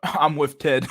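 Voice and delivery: chuckling through the words; laughing. Real, not acted. Joking to chat about Ted, laughing.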